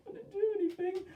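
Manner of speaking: very high, squeaky guilty-dog voice; innocent dog voice. Retold or imitated. Owner voices his guilty dog, squeaky and innocent. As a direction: repeat the plea like a cornered puppy.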